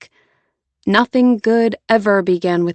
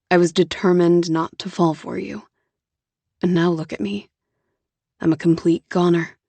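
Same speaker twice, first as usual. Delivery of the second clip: Jonah, tender confession; 3 semitones lower than usual. Acted. Jonah tells Lauren he's a goner, low and tender. Jonah voiced, lower and softer.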